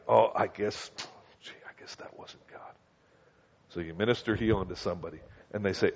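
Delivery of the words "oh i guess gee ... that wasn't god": whispered, giving up; mock the believer who backs down; a meek "gee, I guess that wasn't God"; deflated whisper.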